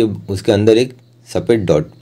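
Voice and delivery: usual speaking voice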